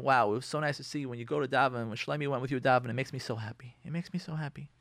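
soft, tender encouragement to husband; gentle praise. Shown as a demonstration. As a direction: coax him kindly with soft praise.

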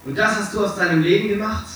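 high-pitched, accusing question; acted outrage of a horrified friend; appalled confrontation. A narrator's reading. Horrified painter confronts Dorian over the picture.

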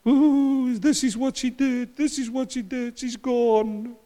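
high wailing widow voice, lamenting; mourning widows. Acted.

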